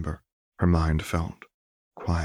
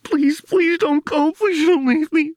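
desperate sobbing plea; 7 dB louder than usual. A narrator's reading. Jinx begging her father through sobs not to leave. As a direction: beg through tears not to be left. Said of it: "Jinx sobbed".

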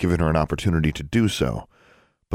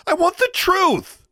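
much higher, louder yell; 4 dB louder, slower than usual. Acted. Daughter yelling at her father, furious. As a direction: shout it at your father. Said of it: "she yelled".

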